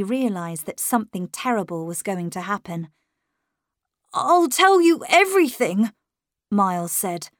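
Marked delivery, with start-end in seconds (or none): 4.1-5.9 put-on high, earnest boy's voice, leaning on tell; a small boy promising anything to keep her close; pleading young boy. Miles promising his governess everything, high earnest boy's voice.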